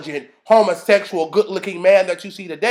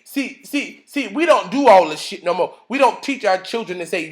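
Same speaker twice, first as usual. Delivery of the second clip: usual speaking voice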